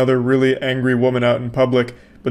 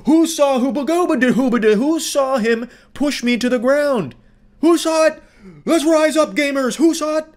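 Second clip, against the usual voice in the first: hammed-up rallying cry of an outraged woman; mock Karen rallying; 12 semitones higher than usual. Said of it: "She tries to, like, rally the people behind her".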